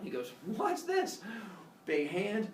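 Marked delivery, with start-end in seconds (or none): 0.6-1.2 high-pitched feigned wonder; mock discovery. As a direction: lure them with high, pretend curiosity. Father Sky lures the Cyclops.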